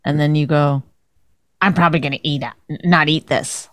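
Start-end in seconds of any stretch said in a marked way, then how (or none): 1.6-3.7 lightly mocking imitation, pitched low; put-on husband voice. Wife imitating husband refusing dinner, lightly put-on low voice. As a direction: quote him in a hint of a put-on voice. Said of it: "why do you do that voice?".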